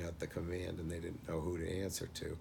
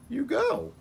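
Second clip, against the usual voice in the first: emphatic order; 9 dB louder than usual. Retold or imitated. Dad's exasperated verdict retold.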